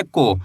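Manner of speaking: "को" with a pure o, falling low; o-vowel demo. Shown as a demonstration. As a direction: say "को" as a plain long o, no glide. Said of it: "कैसे पढ़ेंगे?".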